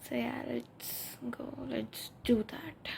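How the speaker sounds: slow unvoiced whisper; sleepy whispered sign-off. Real, not acted. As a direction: breathe it out slowly.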